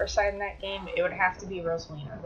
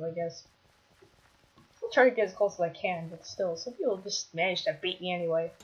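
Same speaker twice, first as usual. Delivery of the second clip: normal talking voice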